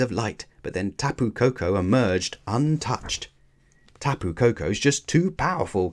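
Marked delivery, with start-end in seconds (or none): none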